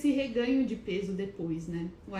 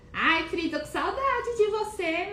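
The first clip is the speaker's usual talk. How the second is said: much higher, louder, gushing; doting high greeting; 10 semitones higher, 6 dB louder than usual. Real, not acted. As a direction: swoon over missing a friend. Missing friend, greeting her.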